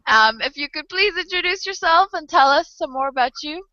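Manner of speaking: ordinary delivery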